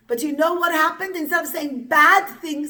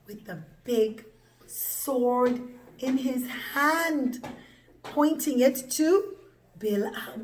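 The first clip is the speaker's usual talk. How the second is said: ordinary delivery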